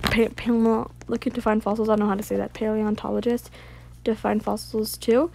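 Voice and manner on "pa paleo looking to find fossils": ordinary talk, nothing marked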